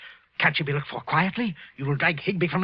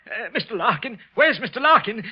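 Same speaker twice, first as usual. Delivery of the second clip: panicked call; 7 semitones higher, 4 dB louder than usual. Acted. Wilson calling out in panic.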